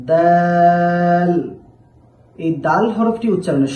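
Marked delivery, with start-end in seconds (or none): 0.1-1.3 দাল stretched very long, louder, almost sung; teacher chanting দাল slowly; drawn-out letter demo. Shown as a demonstration. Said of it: "এরপর আমরা শিখব".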